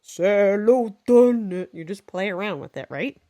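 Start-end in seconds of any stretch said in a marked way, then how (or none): 0.0-1.7 loud, slow monster singing. Shown as a demonstration. Teacher showing kids a monster voice, loud and slow. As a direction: boom it out like a monster. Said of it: "do it in a monster voice".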